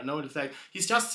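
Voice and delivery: ordinary delivery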